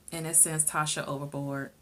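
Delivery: ordinary talk, nothing marked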